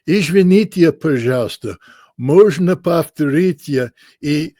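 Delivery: put-on careful, slow American-accented classroom Russian; halting American-accented Russian phrasebook; textbook Russian. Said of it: "in my best two-year college Russian".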